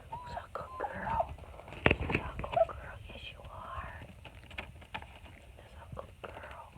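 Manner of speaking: soft whispered coo to a dog; hushed, voiceless pet praise. Real, not acted.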